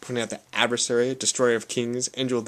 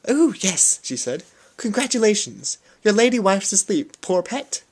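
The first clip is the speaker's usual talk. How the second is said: sweet nurse voice; 9 semitones higher, 4 dB louder than usual. Acted. Nun greets new father sweetly.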